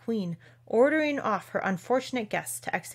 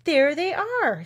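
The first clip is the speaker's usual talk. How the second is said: high, loud, drawn-out triumph; king announcing his proof in triumph; triumphant; 9 semitones higher, 8 dB louder, much slower than usual. A narrator's reading. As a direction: crow it out slowly and proudly. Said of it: "said the king triumphantly".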